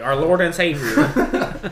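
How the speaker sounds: high, loud, slow proclamation; mock reverence. Mimicking the chatbot on Trump, mock-worshipful. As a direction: proclaim it grandly, breaking into laughter.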